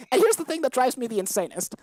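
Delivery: ordinary talk, nothing marked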